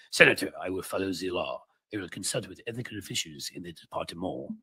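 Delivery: mock French accent, light. Shown as a demonstration. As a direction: quote it again like a Frenchman. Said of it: "I'm supposed to do this in French".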